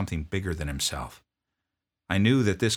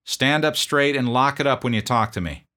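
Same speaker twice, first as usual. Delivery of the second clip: loud, higher drill sergeant rebuke; scolding; 7 semitones higher, 8 dB louder than usual. Retold or imitated. Drill sergeant scolding a private's bearing, loud rebuke. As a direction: snap him back to attention. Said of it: "I scolded him".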